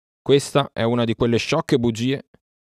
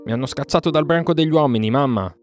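higher-pitched cry to mother; lightly raised, pitched-up call; 7 semitones higher, 3 dB louder than usual. A narrator's reading. Mowgli at Mamma Lupa's den, calling out. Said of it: "gridò Mowgli".

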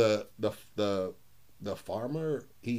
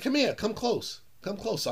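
high, coaxing call to come closer; coaxing grandpa; 9 semitones higher than usual. Retold or imitated. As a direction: lure the boy in, teasing. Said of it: "he said".

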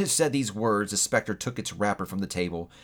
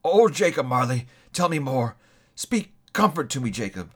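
imploring; 3 semitones higher than usual. A narrator's reading. Scrooge begs Marley's ghost for comfort, pleading. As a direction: beg for comfort, reaching up. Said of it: "he said imploringly".

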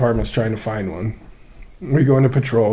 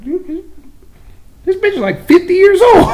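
much higher, squeaky disbelief; mock horrified aside; incredulous; 4 dB louder than usual. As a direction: shriek it in shock.

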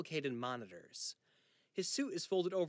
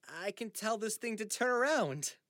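pleading; 8 semitones higher, 5 dB louder than usual. Acted. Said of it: "Charlie pleads".

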